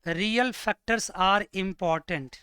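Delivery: slow and spaced for writing down; unhurried note-taking pace; slow dictation. Shown as a demonstration. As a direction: dictate it word by word, slowly. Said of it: "इसको point बना के लिख सकते हो आप".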